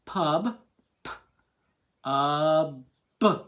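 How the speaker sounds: "Pub" broken into "p", "uh", "b"; sounding out each letter; sounded out. Shown as a demonstration. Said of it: "Now I'm doing each part".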